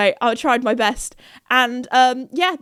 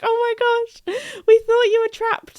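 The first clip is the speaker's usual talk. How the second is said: high, gushing outburst; relieved exclamation; 11 semitones higher, 3 dB louder than usual. Retold or imitated. Greeting the friend leaving the shop, relieved squeal.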